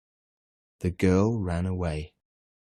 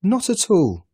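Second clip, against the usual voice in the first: much higher and louder, sharp and indignant; mother angrily defending her son; angry retort; 13 semitones higher, 7 dB louder than usual. A narrator's reading. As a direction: snap back in the boy's defence. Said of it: "Missus Dale said angrily".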